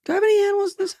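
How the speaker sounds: very high squeaky voice. Pretend. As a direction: squeak it out like a cartoon. Squeaky voice joke.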